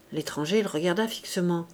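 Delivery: ordinary delivery, nothing marked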